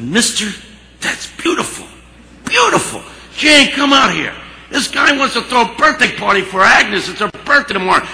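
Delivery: acted high, loud outburst of delight; overjoyed. Harry overjoyed, calls the cook out.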